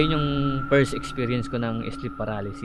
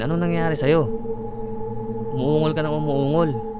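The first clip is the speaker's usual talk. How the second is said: worried parent; 4 semitones higher than usual. Retold or imitated. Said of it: "Sabi niya".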